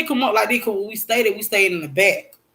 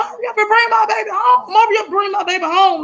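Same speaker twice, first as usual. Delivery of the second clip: retold tearful plea; 12 semitones higher than usual. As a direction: re-enact pleading through tears, pitched way up. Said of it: "I just started crying".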